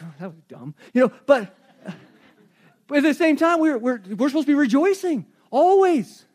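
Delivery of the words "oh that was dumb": much softer, lower sheepish aside; embarrassed mutter. Retold or imitated. Walking away from the campfire, a soft deflated mutter. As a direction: mumble it to yourself, deflated. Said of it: "walk away and be like".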